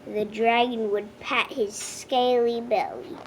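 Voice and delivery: plain reading voice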